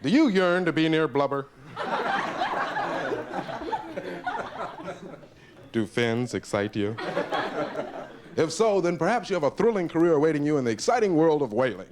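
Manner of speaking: hammed-up recruiting pitch; mock ad read. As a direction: sell whaling like a cheesy ad. Continues matchbook ad.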